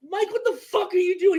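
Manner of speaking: high, yelled at the teammate in disbelief; startled shout of who are you; indignant yell. Retold or imitated. Retold to cohost, a high disbelieving yell. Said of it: "And I'm like".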